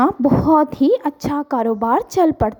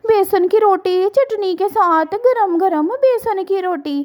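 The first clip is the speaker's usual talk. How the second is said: calling out wares; 8 semitones higher than usual. A narrator's reading. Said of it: "आवाज़ लगाती है".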